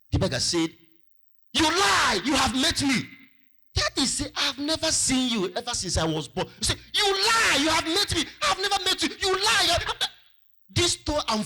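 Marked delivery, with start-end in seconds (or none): 1.5-3.1 loud, high-pitched quarrelling shout; angry accusation. Acted. Beggar accusing artist in acted quarrel. 4.4-6.5 high-pitched protest in an argument; indignant denial. Acted. Artist's denial in acted quarrel. 6.9-10.1 very high, loud squabbling; heated argument. Acted. Rapid acted to and fro quarrel.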